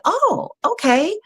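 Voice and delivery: a drawn-out pleased surprise; high surprised okay. Retold or imitated. Guest retells answering the agency, high, slow and surprised. Her reaction to agency offer.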